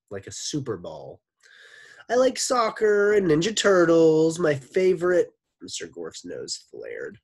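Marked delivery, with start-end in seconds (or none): 2.1-5.3 put-on much higher, louder little girl; small energetic voice. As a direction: bounce it like a super ball. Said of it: "Her voice was small but full of energy".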